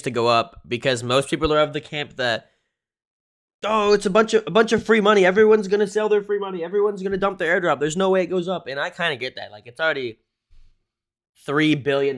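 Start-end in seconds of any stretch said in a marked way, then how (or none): 3.6-8.7 mocking. Mocking skeptical crypto crowd.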